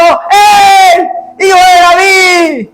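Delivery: grito alto y lento pidiendo ayuda; gritos de auxilio. Acted. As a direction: clama a voz en cuello. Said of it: "estos ciegos empezaron a pegar gritos". (gritos de auxilio de los ciegos)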